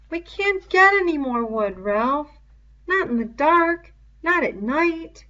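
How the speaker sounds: lightly voiced boy, pleading. A narrator's reading. Boy pleading with Ralph about the wood, lightly voiced.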